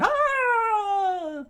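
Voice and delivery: loud, high squeal of pain; high scream. Acted. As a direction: shriek as you get clutched. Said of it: "made him scream".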